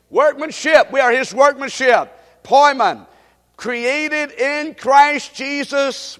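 high, loud, slow proclamation; preaching scripture. Acted. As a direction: declaim the verse word by word. Proclaims verse, breaking for repetition.

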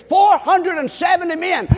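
high, loud emphatic cry; emphatic shout. Real, not acted. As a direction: hammer the number home. Emphatic repeat to congregation.

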